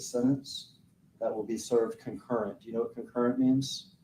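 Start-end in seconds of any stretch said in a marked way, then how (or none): none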